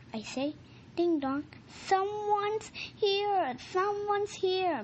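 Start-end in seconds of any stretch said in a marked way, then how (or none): 1.9-4.8 higher, slow, eager repeat; dog Mouse excitedly tells King of the doorbell, excited. Acted. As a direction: announce the visitor with puppy excitement. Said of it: "Mouse says".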